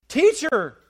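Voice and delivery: frightened disciples calling on their teacher; panicked cry to Jesus. Acted. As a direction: cry out for help in the storm.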